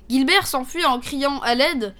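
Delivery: plain reading voice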